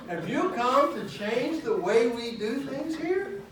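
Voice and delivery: slow, high, loud mocking drawl; dreaded church words. Preacher mocking church members who resist change, slow and loud. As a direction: drag out the dreaded phrase with scorn. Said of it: "aren't those the words".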